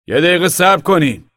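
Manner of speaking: high, loud call to halt. Acted. Guard calling out to halt the truck, loud. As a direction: stop the truck from a distance.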